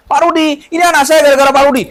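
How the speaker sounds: high, loud, fast gushing; excited woman. Retold or imitated. Mother shows her child to the healer, gushing excitedly. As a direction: burst out in grateful joy.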